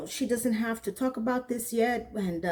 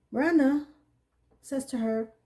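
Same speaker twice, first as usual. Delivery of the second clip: ordinary talk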